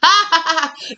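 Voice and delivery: a high amused laugh. Real, not acted. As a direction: laugh at the bit. Laugh after the sassy bit.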